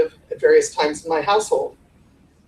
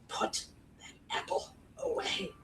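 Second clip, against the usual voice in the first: slow, intense whispered command; urgent whisper; 18 dB quieter than usual. Shown as a demonstration. Coach showing urgency without volume, urgent whisper. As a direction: whisper the order, word by word. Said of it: "Both convey the same sort of urgency".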